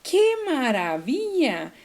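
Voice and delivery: slow, drawn-out ¡qué maravilla!; delighted exclamation. Shown as a demonstration. Teacher showing a long exclamation, delighted. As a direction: marvel at it, stretching each word. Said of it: "expresión larga".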